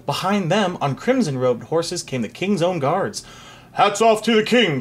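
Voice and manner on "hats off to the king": high shouted order; raised, commanding voice; captain's shout. A narrator's reading. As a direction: call the crowd to attention. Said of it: "shouted the captain of the king's own guards".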